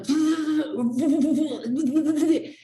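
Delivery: loud, high wobbling noise; wavering comic wail; numb-lip blubber. Retold or imitated. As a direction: blubber as if the lips are numb. Mimics numb lips flapping.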